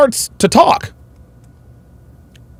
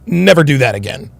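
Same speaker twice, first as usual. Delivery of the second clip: firm, quiet warning; 8 semitones lower than usual. Retold or imitated. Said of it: "but I was firm".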